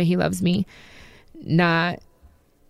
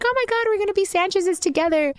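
squealing, much higher girly friend impression; excited friend; 13 semitones higher than usual. Imitating her friend Vanessa's excited squeal, much higher. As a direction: play the friend gushing with excitement. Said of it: "She's like".

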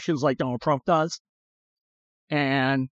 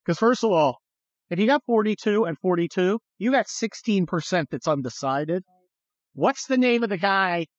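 ordinary talk, nothing marked

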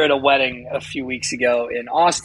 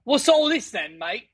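high-pitched mock Cockney; Cockney accent; 7 semitones higher, slower than usual. Shown as a demonstration. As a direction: put on a jokey Cockney accent. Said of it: "like, the Cockney accent".

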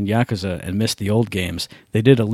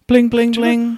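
high sing-song bling bling like a phone alert; high phone notification; 11 semitones higher, slower than usual. Retold or imitated. Imitating a phone alert for friends. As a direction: chime it out like a notification.

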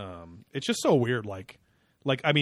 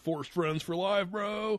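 slow, higher mock bro; slow frat bro drawl; 7 semitones higher, much slower than usual. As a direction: stretch it out like a bro. Imitating the stereotyped frat boy.

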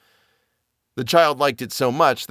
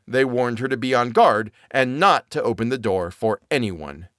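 ordinary delivery, nothing marked

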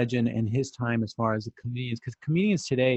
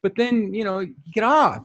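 ordinary talk

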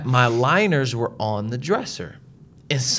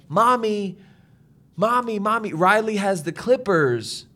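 lightly voiced tattling kid; imitated child; 6 semitones higher than usual. Child alerting Mommy about clippers, imitated urgent calling.

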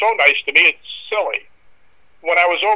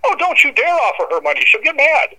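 high, chirpy warning to the guest; a hint of a fussing landlady; lightly voiced landlady; 10 semitones higher than usual. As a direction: playfully scold him off the idea. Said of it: "She goes".

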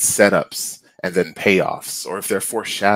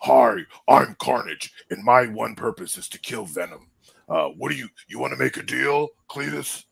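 imitating a cheesy villain intro; mock Carnage voice; 2 semitones higher than usual. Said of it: "they gave us".

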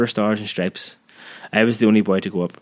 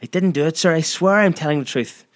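put-on higher, pleading boy's protest; slightly hysterical; 7 semitones higher than usual. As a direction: beg desperately to be believed. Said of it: "my voice slightly hysterical now".

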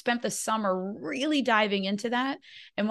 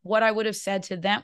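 ordinary delivery

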